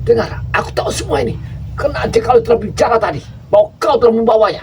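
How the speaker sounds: high, loud angry demand; angry interrogation. Acted. Jakabaya angrily presses the captive for answers. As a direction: press him hard.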